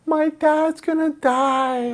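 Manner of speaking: very high, slow frightened wail; panicked kid's cry. Retold or imitated. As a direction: cry out like a scared child. Son voiced crying out.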